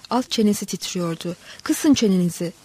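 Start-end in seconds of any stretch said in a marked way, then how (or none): none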